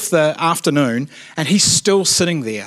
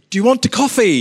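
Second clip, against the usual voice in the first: higher-pitched, awkwardly eager offer; muppet-like offer; 8 semitones higher, 3 dB louder than usual. Acted. Youth worker imagining an offer to a drinker, mock-eager. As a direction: try a hopelessly friendly opener, pitched up. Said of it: "sound like a bit of a muppet".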